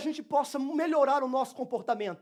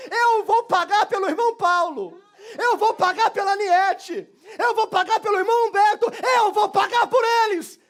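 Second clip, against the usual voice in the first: shouted preaching; 9 semitones higher, 9 dB louder than usual. Acted. Jesus offers to pay, shouted.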